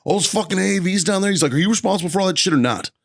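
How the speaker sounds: high, pressing interrogation; stern colonel. Retold or imitated. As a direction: grill him like a hardcore colonel.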